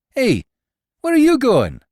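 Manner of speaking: high, loud call after him; alarmed call. Acted. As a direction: stop him as he leaves. Calling after departing companion.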